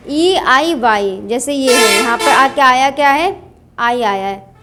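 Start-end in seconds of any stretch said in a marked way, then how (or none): 0.1-1.2 ई आई वाई said slowly and spaced; slow letter naming. Shown as a demonstration. Teacher spelling out letters for the class, slowly. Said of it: "सी के बाद क्या आए?".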